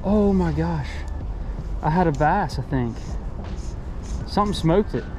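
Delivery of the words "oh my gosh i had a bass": usual speaking voice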